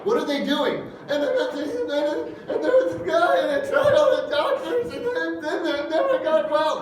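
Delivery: high, breathless, jumbled pleading; hysterical rambling woman. Retold or imitated. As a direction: babble like someone frantic and upset. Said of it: "talked to a hysterical person".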